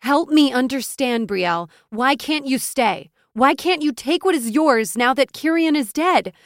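loud, high, insistent questioning; exasperated, pressing appeal; impassioned plea. A narrator's reading. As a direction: plead urgently for an answer. Friend pleading with her to stay.

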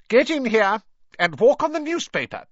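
high, loud, sharp summons; Aunt Petunia calling. A narrator's reading. Aunt calling nephew in from the garden, shrill shout. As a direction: call it out as an order. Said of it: "Aunt Petunia calling him".

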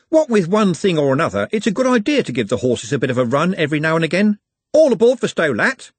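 chatty driver ending on a loud boarding cry; jaunty bus driver. Acted. Driver calls all aboard for Stow Lat, jaunty.

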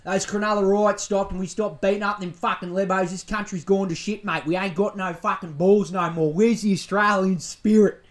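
loud, blokey bogan rant. Retold or imitated. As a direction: play a fired-up country bogan agreeing with the host. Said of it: "out in Burke listening to this being like".

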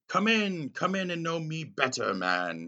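high, loud, hearty giant's welcome; boisterous spirit inviting Scrooge in; jolly booming Ghost of Christmas Present. Retold or imitated. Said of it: "he says".

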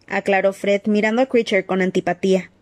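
usual speaking voice

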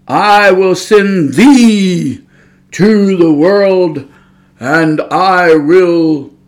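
put-on very slow, solemn old-English voice; King James God voice. Mocking how people imagine God talks, solemn. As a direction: intone it like scripture. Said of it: "talk to you like that".

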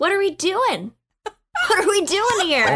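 pushy cheerful loud ask; loud eager question. Acted. Six barging in, loud.

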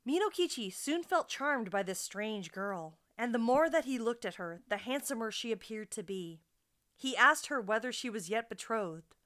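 ordinary talk

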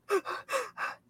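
soft, high sobbing gasps; imitated sobbing. Dreamer imitates crying man coming towards her, mock sobs. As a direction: mimic bawling with short breathy sniffs. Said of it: "bawling his eyes out".

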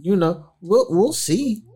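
ordinary talk, nothing marked

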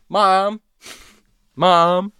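high call across the house. Retold or imitated. Scared teen calls her mom from bed.

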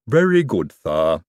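lisping servant voice; Igor lisp voice. Acted. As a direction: bring the lisp back, obliging. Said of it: "going back to the lisping".

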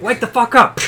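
loud, high-pitched sudden yell; mock wake-up shout. Player acting out waking a sleeping crewmate, mock shout. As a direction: yell it to jolt a sleeper awake. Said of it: "it's like one of those things, like".

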